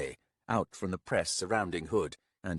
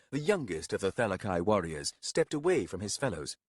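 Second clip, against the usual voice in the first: ordinary delivery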